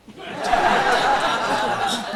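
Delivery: loud, high burst of laughter; loud, high-pitched real laughter at his own story; big real laugh. Real, not acted. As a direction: really crack up. Cracks up at his own punchline.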